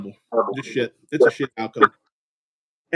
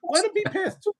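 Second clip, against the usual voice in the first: pitched way up in outrage at the ref; worked-up rant; 9 semitones higher than usual. Real, not acted. Fan fuming at the referee's shitty penalty, high indignant rant. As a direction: rant high and hot at the refs.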